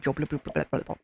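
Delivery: quick, low mock gibberish; gibberish uncle. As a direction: imitate an uncle's unintelligible babble. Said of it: "it was just gibberly".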